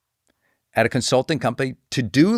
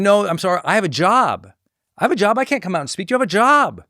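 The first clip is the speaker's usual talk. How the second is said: higher-pitched, emphatic protest; retold flustered turning-down, pushed up in pitch; insistent refusal; 7 semitones higher, 4 dB louder than usual. Consultant turning down a college's speaking invitation, insistent.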